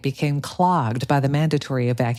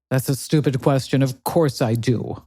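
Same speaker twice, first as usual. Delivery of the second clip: condescending. Acted. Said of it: "replied Konstantin in a condescending tone".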